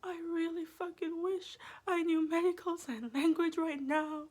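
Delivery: high, laughing through it; mock desperate, cracking up; laughing plea. Patient telling the nurse her wish, laughing. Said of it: "And I'm like".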